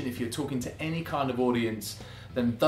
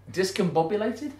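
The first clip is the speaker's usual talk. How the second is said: mocking echo; 6 semitones higher than usual. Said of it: "People don't talk like that".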